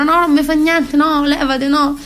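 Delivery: mock a woman waving her partner away; imitating reluctant women. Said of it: "che si tirano indietro".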